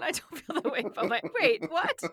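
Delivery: loud, high, shocked protest; indignant protest. Real, not acted. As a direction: object loudly, then catch on in disbelief. Protesting husband insult, rising.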